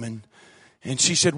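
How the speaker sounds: usual speaking voice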